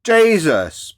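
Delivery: Jesus thrown out loud and high, falling; an exasperated loud cry of Jesus. Real, not acted. Ranting at the guest, a loud exasperated Jesus.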